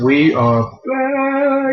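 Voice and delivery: slow, stretched, high welcome back; drawn-out greeting. Acted. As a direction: stretch it out like a host.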